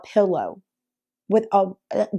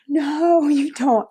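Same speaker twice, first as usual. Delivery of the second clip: mock drunk protest; 7 semitones higher, much slower than usual. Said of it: "I was like".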